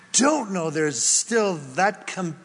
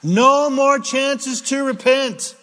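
preaching warning; 7 semitones higher, 5 dB louder, slower than usual. Acted.

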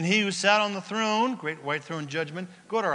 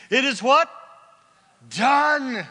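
excited; 8 semitones higher, 10 dB louder, slower than usual. Real, not acted. Crowd call and answer.

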